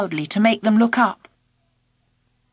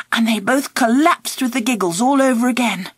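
ordinary delivery, nothing marked

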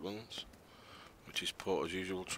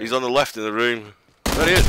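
excited shout; 3 semitones higher, 13 dB louder than usual. Real, not acted. Spots enemy again.

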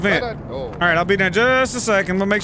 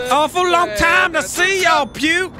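hollered greeting; 10 semitones higher, slower than usual. Acted. Uncle greeting long-unseen family, hollering. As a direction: holler it high like a long-lost relative. Voicing a relative's loud greeting.

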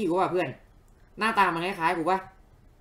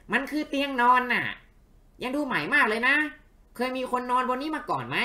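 curious little companion; 8 semitones higher than usual. Acted. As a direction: pitch it way up, inquisitive sidekick.

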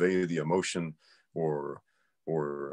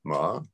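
"ma" swept upward as a query; question tone; 3 semitones lower than usual. Shown as a demonstration. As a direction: say "ma" like you're asking. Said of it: "like you're asking a question".

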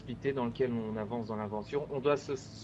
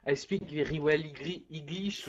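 put-on French-accented broken English; mock English with heavy French accent. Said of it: "I have a good English accent". (anglais avec un gros accent français)

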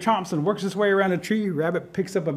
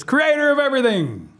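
calling out; 8 semitones higher, 4 dB louder than usual. Acted. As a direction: call up to the creator from afar. Rabbit calls out to creator.

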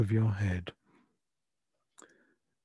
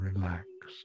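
whispering; 3 semitones lower, 9 dB quieter than usual. Acted. Breathing cue in meditation.

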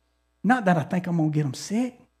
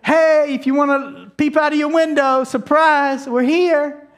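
higher, louder, cheery surprise call; excited call; 6 semitones higher, 6 dB louder than usual. Retold or imitated. Calling to the family at their window, excited and loud. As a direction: shout it up to the window like a happy surprise. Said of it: "I called 'em and said".

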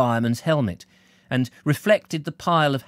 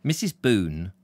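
ordinary delivery

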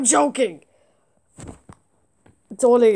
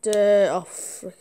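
ordinary talk

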